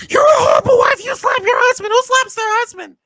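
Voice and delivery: very high, loud mock tirade; hammed-up high-pitched shouting; Vicky impression, outraged. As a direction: shriek the accusations like a scandalized housewife. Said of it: "And then Vicky be like".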